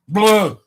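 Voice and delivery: loud disgusted blah. Real, not acted. Disgust noise.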